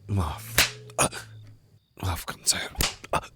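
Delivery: breathless whispered sorry; whispered apology. Acted. Flustered captor whispers sorry to the grieving captive.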